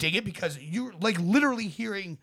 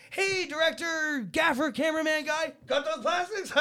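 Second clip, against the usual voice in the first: hammed-up morning call; mock greeting; 10 semitones higher than usual. John asking the crew for plastics, hammed-up call. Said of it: "every morning comes in like".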